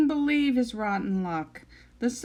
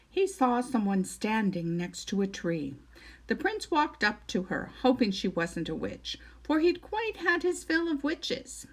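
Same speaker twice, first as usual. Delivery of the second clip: ordinary delivery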